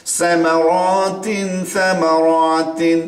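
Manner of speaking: slow drawn-out সামারাতিন; exaggerated recitation. Shown as a demonstration. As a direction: drag out সামারাতিন. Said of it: "আমি একটু বেশি করতেছি". (বাড়িয়ে টেনে পড়া)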